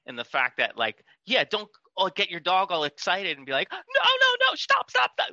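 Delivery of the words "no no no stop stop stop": high, loud frantic dog-owner yell; agitated mock scream, pitched way up; imitated screaming. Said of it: "all the dog hears is screaming".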